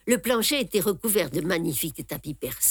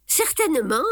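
laughing; 8 semitones higher, 3 dB louder than usual. A narrator's reading. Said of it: "dis-je en riant".

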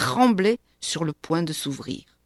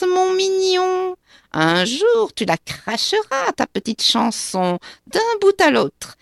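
much higher, louder gloating witch voice; a nasty little witch relishing her revenge; spiteful witch; 10 semitones higher, 7 dB louder than usual. A narrator's reading. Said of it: "petite voix pointue, aigre, méchante". (sorcière méchante et triomphante, voix très aiguë)

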